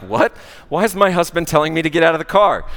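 startled wife who doesn't get it; confused alarm. Retold or imitated. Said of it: "she's like".

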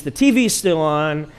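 ordinary talk

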